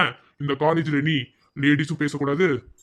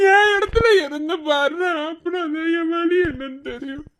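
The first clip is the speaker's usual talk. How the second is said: slow, anguished sobbing; crying in pain. Acted. Man telling friend of his pain, calling Preethi, crying. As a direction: wail it out, sinking into a weak sob. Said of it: "கஷ்டமா இருக்கு".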